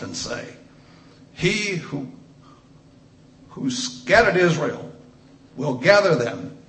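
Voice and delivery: booming pulpit reading of the prophecy; preached scripture. Acted. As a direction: proclaim it to the congregation. Reads prophecy at full force.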